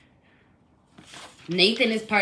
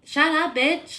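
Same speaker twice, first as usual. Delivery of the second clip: retold yell; 9 semitones higher, slower than usual. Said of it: "that's when she yelled".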